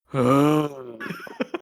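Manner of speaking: long exaggerated sigh; imitating a man's weary, drawn-out sigh; huge sigh. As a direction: heave an angst-laden sigh. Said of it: "the sigh he utters is".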